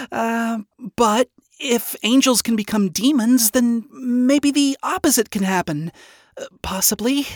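high, hesitant voice with an awkward laugh; nervous chuckle. A narrator's reading. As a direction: laugh nervously through an uncertain answer. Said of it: "Charlie nervously chuckled".

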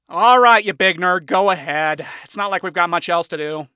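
loud teasing go-ahead; grudging. A narrator's reading. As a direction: wave him on, loudly resigned. Blitzo giving in grudgingly.

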